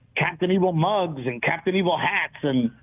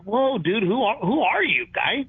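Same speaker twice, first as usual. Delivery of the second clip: mock incredulous; 6 semitones higher than usual. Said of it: "And it's like".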